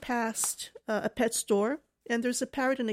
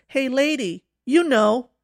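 louder, punched-up taunt for a punchline; parrot's teasing call; 2 semitones higher, 10 dB louder, slower than usual. Retold or imitated. Parrot to the woman passing the pet store, loud teasing call. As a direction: call it out loud, landing hard on the last words.

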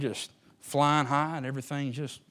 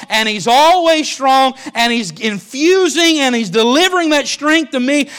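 slow, much higher, louder preaching; full-force preaching; 12 semitones higher, 17 dB louder, slower than usual. Acted. Preacher proclaiming Christ's strength inside believers, full-force preaching. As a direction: drive each phrase home slowly.

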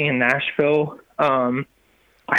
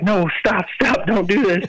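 higher-pitched imagined plea to stop; urgent objection; 6 semitones higher than usual. Retold or imitated. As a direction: cut in with a light retold warning. Quotes the Lord objecting.

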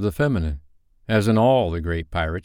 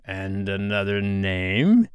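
slow question with a late rise; measured, probing pirate captain; slower than usual. A narrator's reading. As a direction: draw the question out, curious. Hook plays guessing game.

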